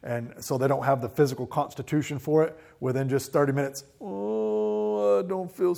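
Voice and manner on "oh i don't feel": put-on high, whiny sick child; sick kid voice. A dad imitating his sick kid.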